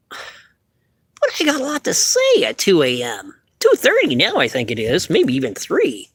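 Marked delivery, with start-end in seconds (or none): 0.1-4.4 higher, slow puppet protest. Acted. Puppet protests late comment.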